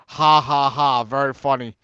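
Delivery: low, deadpan ha-ha-ha; sarcastic fake laugh. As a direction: imitate an unamused teacher, dropping in pitch. Teacher's sarcastic reaction to confession.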